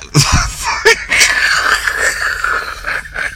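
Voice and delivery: high breathless wheeze of laughter; laughing too hard to make a sound; wheezing silent laugh. Real, not acted. Podcaster cracking up over a song, silent wheezing laugh.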